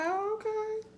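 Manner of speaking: very high, squeaky "oh, okay"; mock surprise. Viewer finally getting the slang, high squeaky realization. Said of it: "You be like".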